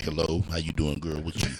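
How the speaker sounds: put-on low, soft sweet-talk to a girl; smooth phone voice. Man calling his lady friend, putting on a smooth low voice. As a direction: drop deep and quiet for the ladies. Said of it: "I used to be like".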